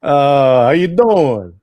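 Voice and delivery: loud, mock New York "how you doing?"; hammed-up New York "how you doing?"; New York accent. Greeting his co-host in a playful New York accent.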